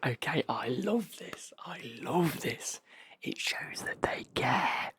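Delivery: eager, slow, stressed whisper; excited whisper. Retold or imitated. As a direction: whisper it with delight. Reading a delighted customer note.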